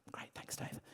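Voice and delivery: breathy whispered thanks. Real, not acted. Speaker thanks Dave quietly aside, whispered. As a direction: thank him under your breath.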